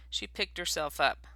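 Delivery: plain reading voice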